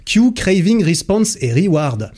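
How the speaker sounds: put-on a lighter repeat of the English accent, cue and craving pitched high; English accent again. Podcaster repeating the step names in a lighter English accent. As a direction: run the English terms again in a showy English accent. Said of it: "j'ai sorti mon plus bel accent".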